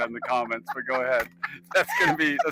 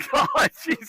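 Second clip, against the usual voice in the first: laughing shriek. Real, not acted. Laughing about getting seasick.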